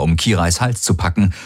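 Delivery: plain reading voice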